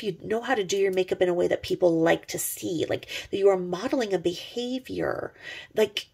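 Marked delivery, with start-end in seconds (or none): none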